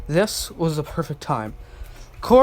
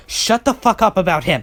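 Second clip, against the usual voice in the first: louder, furious outburst; angry yell; 3 semitones higher, 5 dB louder than usual. Acted. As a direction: snap at him in anger. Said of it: "Corey growled and yelled".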